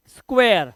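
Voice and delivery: "स्क्वायर" said as skwe-ur; "स्क्वायर" with an air-like ending; eya glide in square. Shown as a demonstration. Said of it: "तो एया की आवाज आ रही है".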